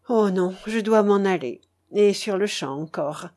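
plain reading voice

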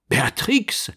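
high-pitched startled exclamation; astonished cry. A narrator's reading. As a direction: cry the name out in amazement. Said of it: "s'écria le vieillard étonné". (cri d'étonnement)

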